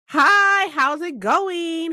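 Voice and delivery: high, slow sweet hello; cute greeting. Real, not acted. Streamer greeting her chat in a high sweet voice. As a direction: coo the greeting.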